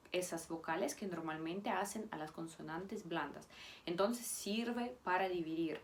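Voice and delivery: ordinary delivery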